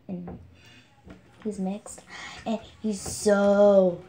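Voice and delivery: ordinary delivery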